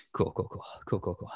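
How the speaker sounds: hushed, half-whispered repetition; soft, breath-heavy self-reassurance; self-soothing mutter. Acted. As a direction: talk yourself down under your breath. Acts parent talking self down.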